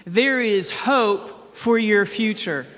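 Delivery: slow call for everyone to say it with him; congregation recitation. Shown as a demonstration. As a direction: lead the room through it slowly. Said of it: "then say it with me".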